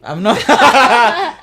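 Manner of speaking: high, loud laughing protest; laughing denial. Real, not acted. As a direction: laugh it off, denying the tears. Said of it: "Why are you crying?".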